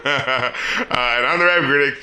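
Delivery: ordinary delivery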